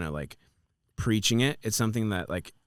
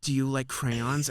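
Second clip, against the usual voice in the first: slow silly question; 4 semitones higher, slower than usual. Retold or imitated. Mocking astrology reader's question.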